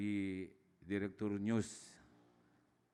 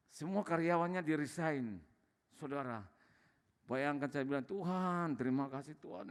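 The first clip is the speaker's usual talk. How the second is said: raised, higher preaching voice, building fervour; impassioned preaching; 4 semitones higher than usual. Acted. Testifying to Bapak Ibu about God's goodness, fervent preaching. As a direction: preach it to the congregation with fire.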